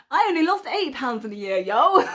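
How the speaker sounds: loud laughing complaint; laughing exclaim. Real, not acted. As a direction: laugh it out in mock envy. Joking envy, laughing.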